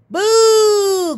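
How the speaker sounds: high, long ghostly boo; little ghosts booing. A narrator's reading. Eight wee ghosts crying boo to dad, high and drawn out. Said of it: "cried the eight".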